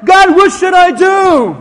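high, full-volume shout; calling to God. Acted. Preacher acting a believer asking God for direction, loud calling out. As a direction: cry out upward at full voice.

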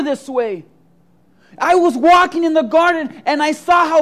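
put-on hurled accusation from an upset child; girl yelling back. A narrator's reading. Girl accusing the gardener who planted the flower, shouting.

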